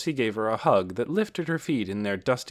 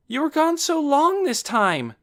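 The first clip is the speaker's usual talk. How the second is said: put-on very high, loud child's voice; excited little brother; 6 dB louder than usual. A narrator's reading. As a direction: pipe up eagerly to a big brother. Boy complains to returned brother.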